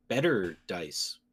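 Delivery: ordinary talk, nothing marked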